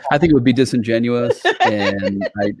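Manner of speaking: amused, laughing reluctance. Real, not acted. Guest laughing off a request for an Australian accent, laughing.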